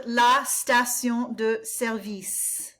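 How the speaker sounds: "service" with the weight on its last syllable, said slowly; slow "la station de service" ending on a stressed vis; stress on the second syllable of service. Shown as a demonstration. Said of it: "Emphasis on the second syllable with service".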